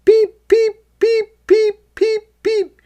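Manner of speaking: very high, loud chick chirps; chirping chicks. A narrator's reading. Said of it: "chirped a whole family".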